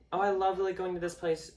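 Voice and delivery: put-on chirpy delight; mimicked enthusiasm. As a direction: imitate someone happily sharing what they love. Mimics someone gushing about a place.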